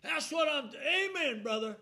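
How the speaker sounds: high, loud holler; man hollering amen at the preacher. Retold or imitated. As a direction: shout it out mid-sermon. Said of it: "just holler right in the service like".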